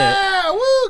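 high, loud whoop of triumph; excited cheer. Real, not acted. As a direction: cheer like you just won. Guessed the joke right.

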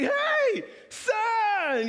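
very high, loud acted holler; hammed-up happy shout; dad calling out. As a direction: hail your child from across the room. Dad hailing his son.

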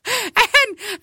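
high laughing shriek; laughing hard. Real, not acted. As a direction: crack up helplessly mid-sentence. Laughing hard after the quote.

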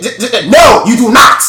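loud, high, cutting-off yell; angry shout. Acted. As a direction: shout him down. Villain cuts off scout.

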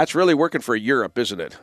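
usual speaking voice

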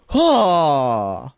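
drawn-out, falling "ohh"; long exclaimed "oh". Acted. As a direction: let out a long sighing cry. Acts out the dashed hope.

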